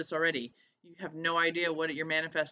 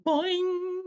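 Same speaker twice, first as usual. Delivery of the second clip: high, loud cartoon spring boing; 13 semitones higher, 6 dB louder than usual. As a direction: pop out a springy comic boing sound. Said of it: "I'm like".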